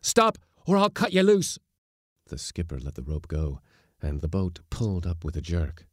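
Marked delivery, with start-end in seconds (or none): 0.0-1.6 high, loud, strained threat; hoarse shout. A narrator's reading. Mate threatening to cut the skipper adrift, hoarse shout. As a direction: shout a desperate warning hoarsely. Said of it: "shouted the mate hoarsely".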